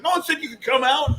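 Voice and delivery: hammed-up coach. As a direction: yell at the kid. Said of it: "He's like".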